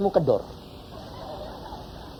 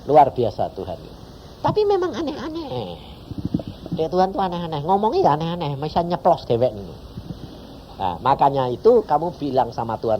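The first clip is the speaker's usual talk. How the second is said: ordinary talk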